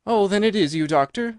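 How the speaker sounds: high, loud burst of relief; great relief. Acted. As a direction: let all the fear out in a sigh of relief. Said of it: "with a great heave of relief".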